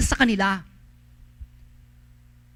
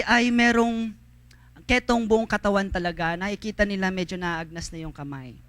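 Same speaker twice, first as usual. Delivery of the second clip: normal talking voice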